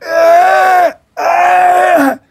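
high, loud yelps of pain; pained yells. Retold or imitated. Yelping in pain on the massage table. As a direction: cry out as the massage digs in. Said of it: "I was going".

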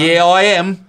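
high put-on Australian accent; a quick Australian twang; mock Aussie. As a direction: answer like a cheeky Aussie.

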